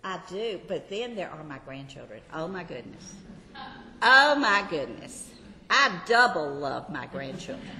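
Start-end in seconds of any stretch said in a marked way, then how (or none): 2.3-3.1 low, soft exclamation; hushed, lowered aside of delight; soft marveling. Real, not acted. Gushing about grandchildren, first take. 4.0-5.3 loud, delighted exclamation; big burst of grandmotherly delight; emphatic gushing. Real, not acted. Gushing about grandchildren, repeated louder.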